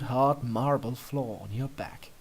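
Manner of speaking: plain reading voice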